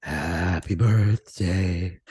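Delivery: low, slow sultry singing. Pretend. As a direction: croon it low and seductive. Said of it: "practiced in my real sultry voice".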